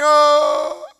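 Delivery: loud, high mock cry; child's wail. A father imitating his son's crying wail. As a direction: wail like a little boy bursting into tears. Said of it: "he started crying".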